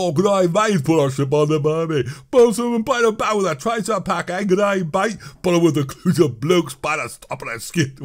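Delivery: hammed-up Aussie gibberish; bad Australian accent. Streamer trying and failing at Australia, bad Australian accent. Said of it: "My accent is horrible".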